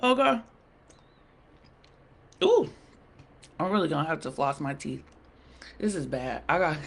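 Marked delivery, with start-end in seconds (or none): none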